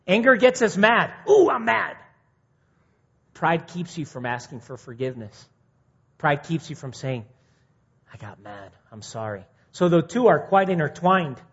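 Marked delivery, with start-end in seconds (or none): 1.3-2.0 high, hammed-up pout of anger; mock mad. Preacher mocking an angry person, hammed-up high pout. 8.1-9.5 much softer, low and humble; quiet apology. Retold or imitated. Preacher voicing someone apologizing, soft and humble.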